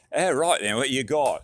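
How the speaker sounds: put-on hamming up a Scotsman's British accent. Walmart guy greeting him, in a hammed-up British accent. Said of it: "British, Scottish".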